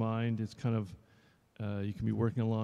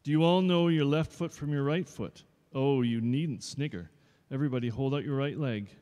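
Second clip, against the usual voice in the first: louder, a touch higher stern teacher; strict dance teacher; 4 semitones higher, 4 dB louder than usual. Acted. Dance teacher addressing new pupils, mildly stern. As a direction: lay down the rules.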